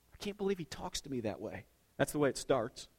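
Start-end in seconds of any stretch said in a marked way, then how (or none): 0.1-1.7 much softer, hurt murmur; wife's hurt complaint. Retold or imitated. Wife complaining about her husband's tone, soft and hurt. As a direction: say it to yourself, wounded.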